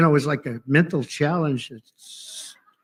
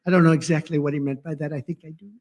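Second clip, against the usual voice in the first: ordinary delivery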